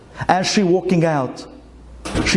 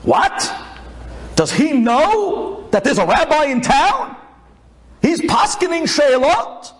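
high-pitched indignant outburst; incredulous outrage, pitched right up; angry gabbai; 9 semitones higher than usual. Acted. Gabbai hears of a rival to his rabbi, angry disbelief. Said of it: "The gabbai gets angry".

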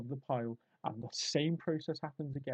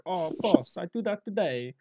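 high-pitched mock Italian worker; comic Italian lilt; Italian accent; 5 semitones higher, 7 dB louder than usual. Italian worker answering his boss, mock Italian accent. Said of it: "I say Italian".

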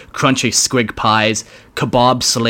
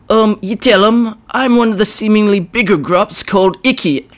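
high, louder whiny goblin; nervous grot; 11 semitones higher, 4 dB louder than usual. Acted. Icky pushing a smaller grot forward, nervous whine. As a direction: shove the blame away nervously.

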